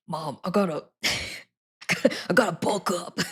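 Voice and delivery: lightly put-on husky man; a hint of husky gym guy. Imagining telling mom on the phone, mock husky man voice. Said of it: "So give my husky man voice".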